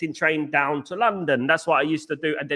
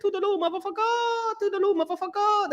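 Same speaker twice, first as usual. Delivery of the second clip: Chow impression; 4 dB quieter than usual. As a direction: squeal it up high like Chow, stretching motherfucker. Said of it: "You're like that, isn't it?".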